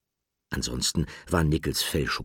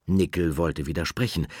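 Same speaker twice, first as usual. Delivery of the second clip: ordinary delivery, nothing marked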